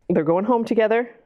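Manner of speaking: ordinary delivery, nothing marked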